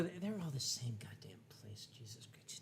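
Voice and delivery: exasperated whisper under the breath; whispered aside. Real, not acted. Host muttering to himself about the towns, in a whisper. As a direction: hush it, fed up with the names.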